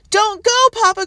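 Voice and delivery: put-on high, loud children crying; pleading cry. A narrator's reading. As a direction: beg their father to stay. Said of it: "cried Hope and Mercy".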